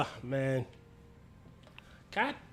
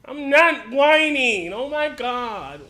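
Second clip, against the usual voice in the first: high, loud, very slow whine; whiny voice; 6 semitones higher, 8 dB louder, slower than usual. Real, not acted. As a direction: protest in a drawn-out whine. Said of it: "You said that in a whiny voice".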